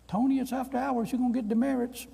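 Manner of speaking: put-on high old professor voice; old man's voice.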